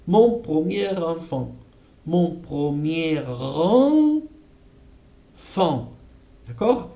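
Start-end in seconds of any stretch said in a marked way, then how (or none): 0.1-1.5 "mon premier enfant" with r linked into enfant; natural liaison. Shown as a demonstration. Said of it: "liaison natural". 2.1-5.9 "mon premier" slow, then "enfant" apart; slow and separated; slow split demo. Shown as a demonstration. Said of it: "Se escucha, sí o sí".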